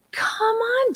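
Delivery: high, exasperated inner protest; voiced exasperated thought; retold annoyance. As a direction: let pent-up annoyance squeak out. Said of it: "my own anger and annoyance".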